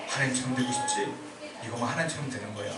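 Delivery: low, hushed coaxing; quiet, low voice of a sly deceiver; Satan as tempter, imitated. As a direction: tempt softly, as if confiding. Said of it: "얼마나 좋은 말로 연기를 잘해서".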